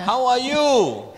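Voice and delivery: high, slow, sing-song hello; hammed-up greeting. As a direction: greet her in an exaggerated high voice.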